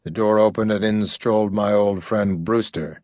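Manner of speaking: ordinary delivery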